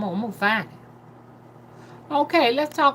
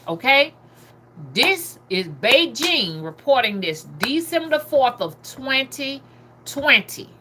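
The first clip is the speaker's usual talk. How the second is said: normal talking voice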